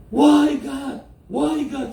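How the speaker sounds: high-pitched retold plea to God; imitated anguished cry. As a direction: mimic victims calling out to heaven. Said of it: "Begitu mereka teriak".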